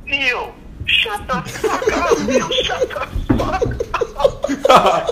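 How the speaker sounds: high, loud, slow laughing yell; mock scream. Buddy in the next cell yells at Neil, loud mock scream. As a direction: bellow it at the noisy guy next door, half laughing. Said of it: "just starts screaming like".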